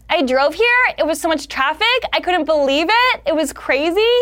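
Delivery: high uptalk, "here", "traffic" and "crazy" all rising; relentless rising lilt ending on "crazy"; everything a question. Acted. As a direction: turn statements like "It was crazy" into questions. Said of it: "every single thing was a question".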